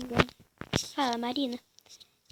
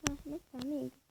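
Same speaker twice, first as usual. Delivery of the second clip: ordinary delivery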